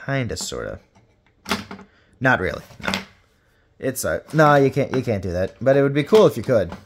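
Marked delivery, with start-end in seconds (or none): none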